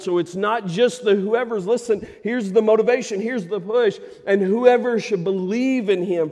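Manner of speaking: loud, high, driving sermon delivery; a preacher pushing the crowd, loud and high; full-force preaching. Acted. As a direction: preach it hard to the congregation.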